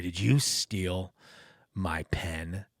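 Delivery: low, soft, slow menace; a boss grilling a rookie in a low, quiet menace; menacing growl-whisper. Retold or imitated. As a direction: hiss the question low and slow. Reenacting Furman's threat.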